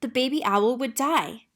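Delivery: ordinary delivery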